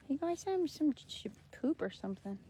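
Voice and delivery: hushed whisper to the hen. Real, not acted. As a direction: breathe it as a secret. Confiding to the hen.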